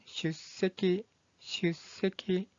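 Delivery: 슈세키 slowly, with a held stop before 세; pronunciation demo. Shown as a demonstration. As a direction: model 슈세키 in slow, separated syllables. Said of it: "이 한자의 발음은".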